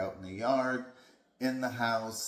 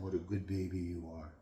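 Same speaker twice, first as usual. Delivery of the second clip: praising dog; 4 semitones lower, 6 dB quieter, faster than usual. Shown as a demonstration.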